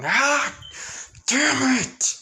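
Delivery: high, loud furious scream; frustrated scream. Acted. Aora venting rage before destroying a rock, loud scream. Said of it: "she just screams in frustration".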